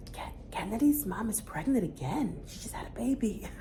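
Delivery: put-on soft hushed child's gossip; whispering. A child whispering about a mom being pregnant again, hushed. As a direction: whisper it like a kid telling a secret. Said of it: "whispering to another child".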